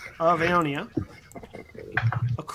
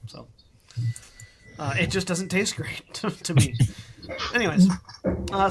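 normal talking voice

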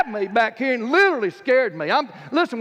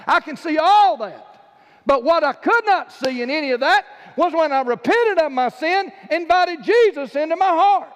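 rising pitch, louder, pressing the point home; fiery preaching; 6 semitones higher, 4 dB louder than usual. Acted. Preacher warning the congregation, loud and rising.

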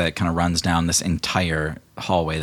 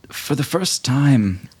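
slow, higher, dreamy confession; slow lovestruck declaration; 6 semitones higher, 3 dB louder than usual. Acted. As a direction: draw out each word like a swooning suitor. GM voices lovestruck suitor.

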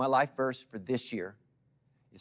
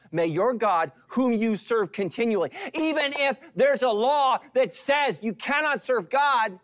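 full-force preaching; 12 semitones higher, 10 dB louder than usual. Acted. Pastor repeats the prayer, preaching.

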